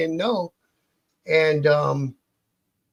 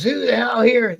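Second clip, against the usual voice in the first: a raised half-yell, higher and louder; retold yell; 6 semitones higher, 6 dB louder than usual. Mechanic calls out across the empty pub, half-yelling. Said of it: "and he yells".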